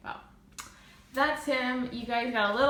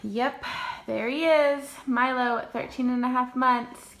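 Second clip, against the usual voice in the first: usual speaking voice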